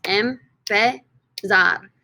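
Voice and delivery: "Empezar" in three slow, separate syllables. Shown as a demonstration. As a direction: break "Empezar" into em, pe, zar with pauses. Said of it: "three syllables".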